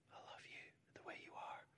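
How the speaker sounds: soft unvoiced whisper; whispered love. Real, not acted. Pastor modelling self-love, whispering tenderly.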